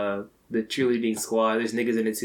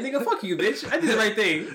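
defiant; 9 semitones higher than usual. Retold or imitated. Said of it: "he remembered that, like".

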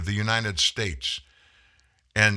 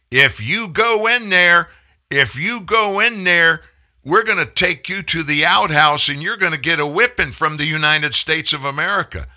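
finger-wagging threat; 7 semitones higher, 7 dB louder than usual. Retold or imitated. Mocking Biden threatening Putin, high loud scolding. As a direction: scold like a bossy parent with a switch. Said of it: "wagging his finger at Putin".

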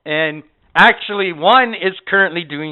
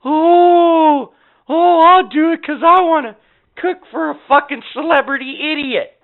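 high, gushing mock fan voice; mock starstruck gush; 11 semitones higher, 5 dB louder than usual. Mocking the movie star's expectation, in a squealing fan voice. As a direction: parody a fawning fan, pitch way up. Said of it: "or I was gonna go".

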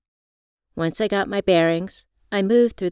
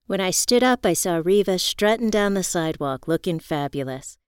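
usual speaking voice

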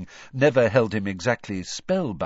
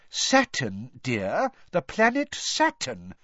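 high, impatient seer; irritated; 5 semitones higher, slower than usual. A narrator's reading. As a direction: repeat it with irritation. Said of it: "sounding definitely irritated".

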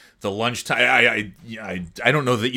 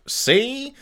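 a hint of old gangster; 13 semitones higher than usual. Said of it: "my grandmother would drop".

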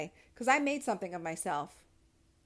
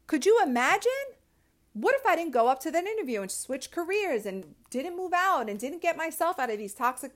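higher and louder, incredulous; excited disbelief; 7 semitones higher, 3 dB louder than usual. Real, not acted. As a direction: ask it like you can't believe it. Excited rhetorical questions.

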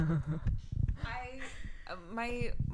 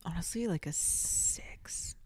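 whispering; 10 dB quieter, slower than usual. Real, not acted. Secretive rating of ex-self.

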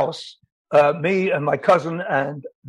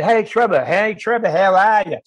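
put-on Australian accent; 4 semitones higher than usual. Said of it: "he's Australian".

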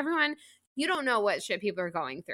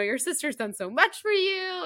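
mock protective; 10 semitones higher than usual. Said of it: "defending his wife and is like".

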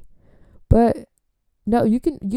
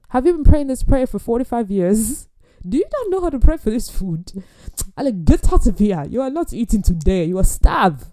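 loud, hammed-up telling-off; mock outraged scolding; 4 semitones higher, 6 dB louder than usual. Host scolding guest for preschool prayer, loud mock outrage. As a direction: berate them in playful outrage. Acted scolding of childish grace.

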